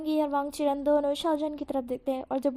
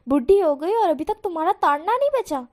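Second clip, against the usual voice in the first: jealous scolding; 3 semitones higher, 8 dB louder than usual. Acted. Husband scolding his wife for ogling teenagers, loud. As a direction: tell her off jealously.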